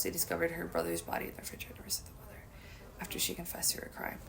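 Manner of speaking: hushed whisper. Real, not acted. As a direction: breathe the words out. Reading grim news article.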